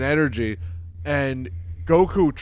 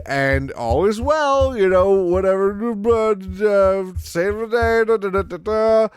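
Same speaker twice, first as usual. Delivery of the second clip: high, singsong skim through the plot; breezy glossing-over; 8 semitones higher, 4 dB louder than usual. Acted. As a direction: rattle off a mock happy ending. Breezily skipping over the heroes' victory.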